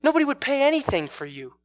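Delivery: high, loud, cutting jab; acted scornful insult. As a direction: fling it out to crush them. Abuser's final insult.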